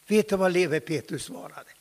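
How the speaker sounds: ordinary delivery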